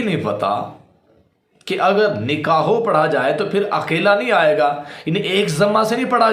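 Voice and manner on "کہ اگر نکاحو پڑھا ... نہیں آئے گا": normal talking voice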